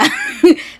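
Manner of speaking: high squealing laugh; cracking up at her own joke; burst of laughter. Real, not acted. Telling the dad joke, she bursts into high laughter.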